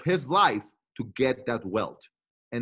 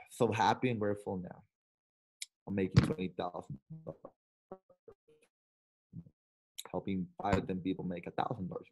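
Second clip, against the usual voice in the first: softly reading the note aloud; hushed, reading his words; a quiet read-out of a stranger's affirmation; 7 semitones lower, 9 dB quieter than usual. Retold or imitated. Reading the man's quiet message.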